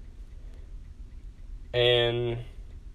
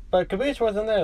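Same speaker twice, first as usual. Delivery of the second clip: usual speaking voice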